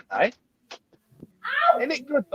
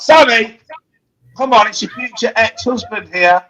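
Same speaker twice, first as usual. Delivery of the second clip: raised voice calling out; yelling across the room; 7 semitones higher, 5 dB louder than usual. Real, not acted. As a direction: call her from the next room. Calling to Sammy in other room.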